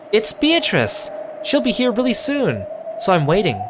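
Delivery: bubbling over that the witch is coming; a small girl insisting the witch will come; excited child. Acted. Maria eager about Beatrice.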